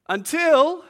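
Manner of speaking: sudden high, loud until; spring the twist on the crowd; dramatic until. Acted. Preacher building dread in night fishing story, sharp loud until. Suspense turn in a scary story.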